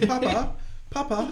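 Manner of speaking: put-on high-pitched kid calling for his dad; child's voice. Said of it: "sometimes he says".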